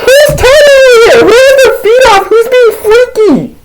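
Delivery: very high falsetto wail; hammed-up shrieking interrogation; hysterical friend. Homie demands to know who's being freaky, hysterically.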